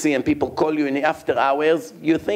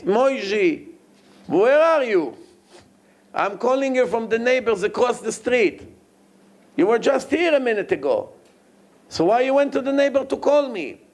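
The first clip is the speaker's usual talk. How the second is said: puzzled father; 7 semitones higher than usual. Retold or imitated.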